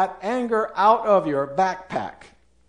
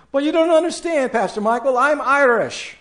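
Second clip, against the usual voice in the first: much higher, faster and louder, a hammed-up whiny protest; protesting excuse; 9 semitones higher, 5 dB louder, faster than usual. Congregant protesting to Pastor Michael about their temper, high whiny protest. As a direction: squeal it out like a caught-out excuse.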